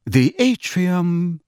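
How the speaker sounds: measured lift announcer; cool female voice. A narrator's reading. Lift voice announcing the floor, cool and slow. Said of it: "said the cool female voice".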